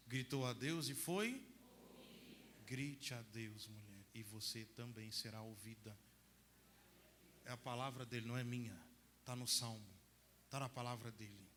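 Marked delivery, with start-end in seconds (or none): 2.7-6.0 tender exhortation. Real, not acted. Priest softly urging women to cry to God. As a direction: gently urge each woman. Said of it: "slow soft exhortation".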